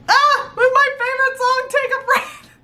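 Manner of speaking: high, fast delighted squeal; excited squeal. Real, not acted. Fan finds her favorite song, squealing high and fast.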